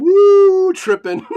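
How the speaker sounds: a high whoop breaking into loud laughter; real giddy laughter. Real, not acted. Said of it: "And I'm laughing".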